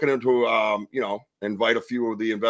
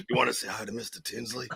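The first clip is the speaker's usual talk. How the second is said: goofy put-on buddy voice, much softer; lowered, sly put-on voice; Animal impression; 10 dB quieter than usual. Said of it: "That's my Animal impression".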